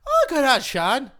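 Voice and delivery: high, loud, slow fake Irish; mock Irish accent. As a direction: draw it out in a hammed-up Irish accent. Playing along in Irish accent.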